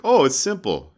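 high, loud retold answer; breezy know-it-all imitation, pitched well up; imitated Igor. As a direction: toss it off like a mimicked expert. Voicing Igor's answer to interviewer.

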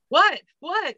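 two sharp, high disbelieving whats; retold shock, pitched high; surprised. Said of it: "and I'm like".

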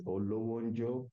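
"Olowonjo" said slowly and clearly, each syllable given; name pronunciation. Shown as a demonstration. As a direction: say "Olowonjo" carefully, o-lo-won-jo. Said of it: "how to pronounce your full name".

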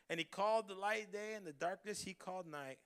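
normal talking voice

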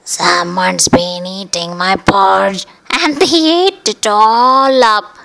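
put-on Baby Bear crying over his empty bowl; cried. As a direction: build from pout to cry ending in a squeal. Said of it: "cried the baby bear".

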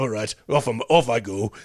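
grumpy Father Christmas voice; grudging curmudgeon. Acted. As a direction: give in grudgingly, like an old curmudgeon. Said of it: "just a real curmudgeon in them".

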